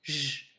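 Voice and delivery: J as a sustained French "zh"; soft J sound. Shown as a demonstration. Teacher sums up the two sounds, J as buzzed "zh". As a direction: sound J out as "zh", not its letter name. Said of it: "ou parfois le son".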